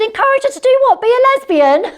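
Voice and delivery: high and loud mock outrage; cheeky comeback to husband; retold retort. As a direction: fire the question back, scandalised. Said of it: "I said".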